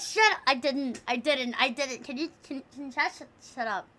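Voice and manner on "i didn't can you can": usual speaking voice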